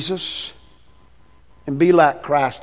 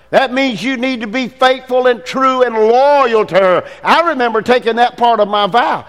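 raised, higher, louder preaching voice, pounding each line; preaching at full force; 8 semitones higher, 5 dB louder than usual. Acted. Pastor urging husbands to honor wives, preaching full force. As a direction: preach it to the men like a charge.

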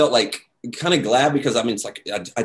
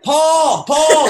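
much louder, higher call across the room; hollering; 9 dB louder than usual. Retold or imitated. Kelly calling Paul over to the narrator, hollering loudly. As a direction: holler his name across the lobby. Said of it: "hollering over to me".

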